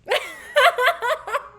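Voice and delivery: loud, high-pitched burst of laughing; burst of laughter. Real, not acted. Friend cracking up over a promised date, loud high laugh. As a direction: crack up loudly.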